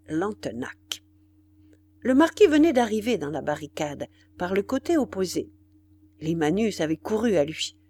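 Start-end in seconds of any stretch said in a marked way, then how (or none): none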